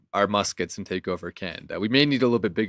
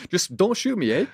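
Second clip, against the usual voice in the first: mock Canadian; 9 semitones higher than usual. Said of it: "better at accents than me".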